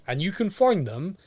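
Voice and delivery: usual speaking voice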